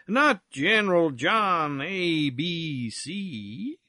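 very slow, swooping drawl; languid, drawn-out questioning; drawled syllables. Acted. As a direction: stretch every syllable, rising at the end. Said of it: "drawling out his syllables".

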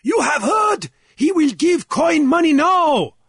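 high, ringing shout to a crowd; loud cry. A narrator's reading. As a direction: call out to a whole assembly. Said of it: "cried in a loud voice to the assemblage".

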